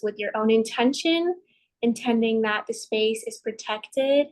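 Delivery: usual speaking voice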